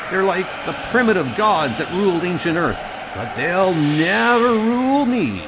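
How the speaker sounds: high, loud cry of defiance; defiant shouting. Acted. Defiant cry against storm gods.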